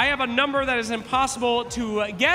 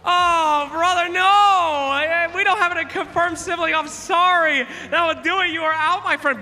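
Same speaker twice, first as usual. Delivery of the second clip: normal talking voice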